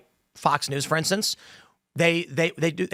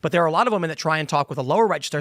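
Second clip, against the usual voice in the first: ordinary delivery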